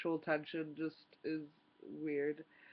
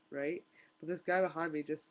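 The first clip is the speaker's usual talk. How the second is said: ordinary delivery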